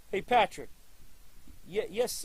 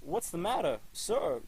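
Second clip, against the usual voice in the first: usual speaking voice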